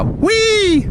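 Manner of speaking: high mock squeal; playful whee. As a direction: squeal it like a kid on a ride. Mock jump off bridge.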